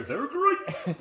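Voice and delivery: loud, high cartoon mascot catchphrase; Tony the Tiger. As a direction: roar it out like a cereal mascot. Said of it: "I sound like Tony the Tiger".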